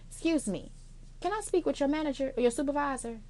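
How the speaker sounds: soft, even, polite request; quiet polite phone voice; calm and polite. Shown as a demonstration. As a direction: ask sweetly, keep it cool. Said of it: "keep that shit calm".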